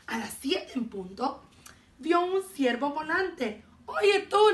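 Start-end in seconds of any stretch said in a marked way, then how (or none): 3.9-4.5 high, loud hail; brash call. A narrator's reading. Grumpy ladybug hailing a stag beetle, loud and brash. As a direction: call out pushily.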